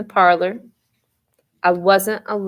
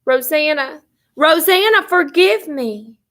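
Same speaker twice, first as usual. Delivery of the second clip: delirious yelling; 8 semitones higher than usual. A narrator's reading. Sick sister calling for Rosanna, delirious yell. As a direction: call out for her, begging forgiveness. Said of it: "She kept yelling for Rosanna".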